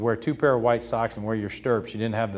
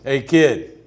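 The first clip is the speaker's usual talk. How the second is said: put-on louder, booming old legend; big booming voice; 3 semitones higher, 7 dB louder than usual. Ted Williams calling the young pitcher over, booming. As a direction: boom it out at the kid. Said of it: "I hear a big boom of voice".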